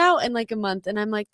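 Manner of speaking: ordinary delivery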